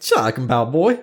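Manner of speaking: put-on twang it up like a country boy; mock Southern twang. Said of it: "You got a twang".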